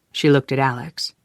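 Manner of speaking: ordinary delivery, nothing marked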